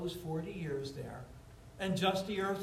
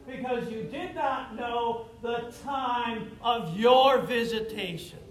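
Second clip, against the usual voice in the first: higher, measured prophetic lament; solemn scripture reading; 4 semitones higher than usual. Acted. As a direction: read the lament with grave weight. Reading Jesus' lament over Jerusalem.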